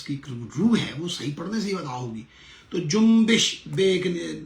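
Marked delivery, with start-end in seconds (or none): 2.8-3.6 جنبش said loud and high, with a clear zer under the be: jum-bish; careful pronunciation. Shown as a demonstration. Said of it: "بے کے نیچے زیر پڑی ہے". (جنبش کو زیر کے ساتھ زور سے واضح بولیں)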